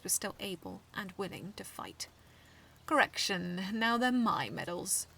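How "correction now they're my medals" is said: slow, smug correction. A narrator's reading. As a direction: gloat it, slowly. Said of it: "he stated smugly".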